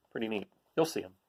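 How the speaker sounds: ordinary delivery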